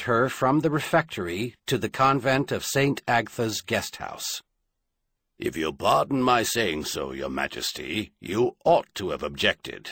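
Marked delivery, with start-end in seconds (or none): 5.4-9.9 low, sour captain's voice; sour guard captain. A narrator's reading. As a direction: grumble it with disapproval. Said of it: "just a bit sourly".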